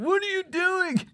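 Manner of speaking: very high, slow squeaky confused voice; confused caller. Retold or imitated. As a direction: squeak it out like a baffled guy on the phone. Adam on the phone, confused.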